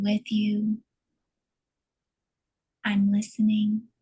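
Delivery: gentle meditation affirmations. Acted. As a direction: speak tenderly to your own body, leaving long pauses. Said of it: "Saying gently to the body".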